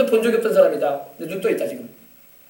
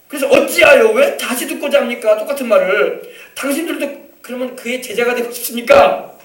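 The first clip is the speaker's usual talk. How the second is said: raised, high challenging questions; annoyed retort; 4 semitones higher, 4 dB louder than usual. Acted. Healed blind man challenging the Pharisees, annoyed. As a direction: act out an irritated comeback building to the last word. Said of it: "짜증을 내는 거야".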